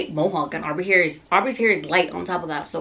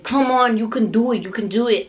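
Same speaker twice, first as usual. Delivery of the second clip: higher, insistent encouragement; urging; 5 semitones higher, 4 dB louder than usual. Retold or imitated. Partner cheers on laboring mom, urging. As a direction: imitate a partner's pep talk.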